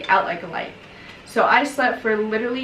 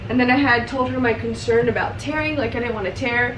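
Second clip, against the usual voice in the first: ordinary delivery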